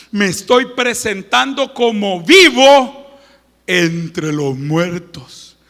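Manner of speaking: ordinary talk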